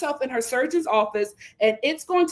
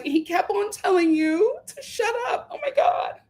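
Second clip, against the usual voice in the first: high, hammed-up fake concern; mock the host with a shrill pretend panic; high mocking impression of the host; 7 semitones higher than usual. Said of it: "The host was like".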